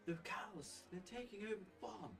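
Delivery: a first rough try at the farm woman; wrong Frida voice. Acted. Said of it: "That's not how she sounds, actually".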